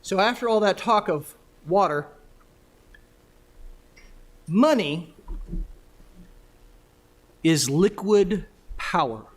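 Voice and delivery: loud, measured delivery with long pauses; formal speech. Real, not acted. Formal talk after the poem.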